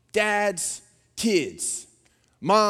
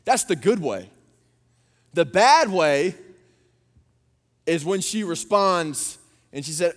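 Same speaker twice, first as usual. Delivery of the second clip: normal talking voice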